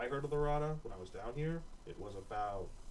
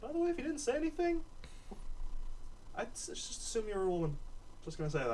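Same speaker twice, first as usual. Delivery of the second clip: higher, fast teasing aside; the woman; 12 semitones higher than usual. Acted. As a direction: tease him lightly, quickly. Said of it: "she looks over at Taylor and goes".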